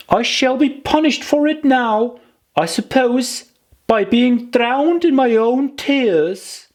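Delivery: put-on high childlike voice, a little worried; a little girl scolding herself; high little girl, rueful; Alice, rueful. A narrator's reading. Alice worries while swimming.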